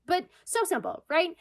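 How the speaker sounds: ordinary delivery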